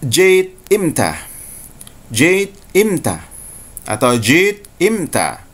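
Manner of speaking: slow Saudi "jait imta"; Saudi Arabic. Shown as a demonstration. Teacher showing students the Saudi dialect phrase. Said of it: "Orang Saudi akan mengatakan".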